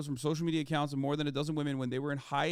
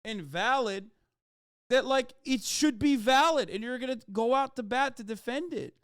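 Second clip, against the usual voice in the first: normal talking voice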